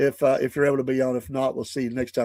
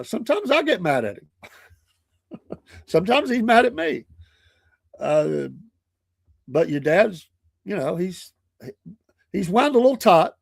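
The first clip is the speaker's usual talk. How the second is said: put-on higher, coaxing voice to a child; calm the granddaughter with a chuckle; grandpa explaining gently; 6 semitones higher than usual.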